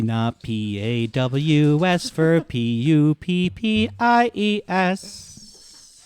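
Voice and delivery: hammed-up announcer voice stretching every word; mock announcement. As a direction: announce it like a grand public notice, spelling each letter out. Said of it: "Please leave that part in the podcast".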